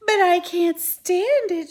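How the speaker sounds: high, loud, slow anguished shout; desperate shout. Acted. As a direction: shout it in despair. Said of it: "shouted Wilbur".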